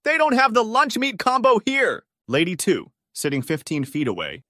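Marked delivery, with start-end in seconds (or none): 0.0-2.0 high, loud yell across the room; shrill-pitched full yell, carried long. A narrator's reading. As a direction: holler it to a friend far away. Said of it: "literally yells".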